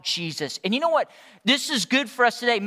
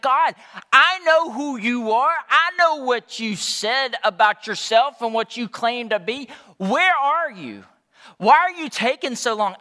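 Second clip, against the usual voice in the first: anguished questioning of God; 4 semitones higher, 6 dB louder than usual. Acted. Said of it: "It's like".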